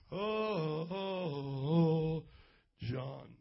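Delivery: low, droning holy voice, mocking; hammed-up solemn moaning; mock pious Jesus. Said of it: "He wasn't talking like that".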